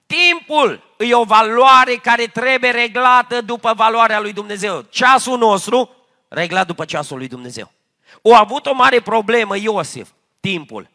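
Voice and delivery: loud, fervent preaching, driving each phrase home; preaching at full force. Acted. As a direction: proclaim it to the whole congregation. Preacher raises voice again.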